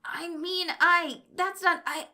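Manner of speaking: high, loud, broken protest; flustered stammering. A narrator's reading. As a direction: stumble over it, pitch way up. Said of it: "Kyle stammered".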